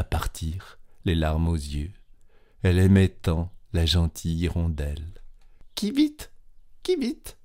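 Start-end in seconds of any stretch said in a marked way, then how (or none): 5.7-7.4 very high chirped bird call. A narrator's reading. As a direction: chirp it like a swallow. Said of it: "fit encore une fois l'oiseau". (cri d'oiseau très aigu)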